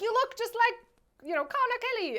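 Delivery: high, teasing voice for the movie's line; high mocking quote. Retelling the film's line in a high voice. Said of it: "they're like".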